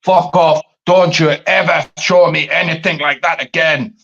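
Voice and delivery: mock-furious Scottish accent. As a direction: ham up an angry Scottish outburst. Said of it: "best Scottish accent I can do".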